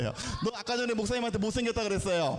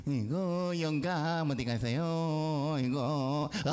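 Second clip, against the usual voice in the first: put-on slow, low old-woman voice; doddering granny calling her husband; 5 semitones lower, much slower than usual. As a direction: drag it out like an elderly wife. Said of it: "완전 할머니지".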